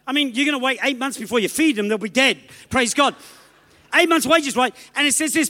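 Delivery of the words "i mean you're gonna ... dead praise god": ordinary delivery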